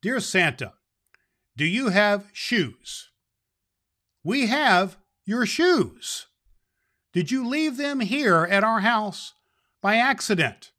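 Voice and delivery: put-on child's letter voice. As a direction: read it as the small boy who wrote it. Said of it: "here is our letter to Santa".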